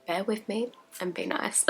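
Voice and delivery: soft shy whisper. Real, not acted. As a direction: whisper it, a bit embarrassed. Shy repeat of her plea.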